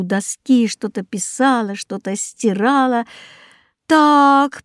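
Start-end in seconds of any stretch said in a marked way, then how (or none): 3.9-4.6 a long, louder and higher drawn-out so; the teacher stretches out his verdict on the board. Acted. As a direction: stretch the word out as you look over her work. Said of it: "протянул учитель".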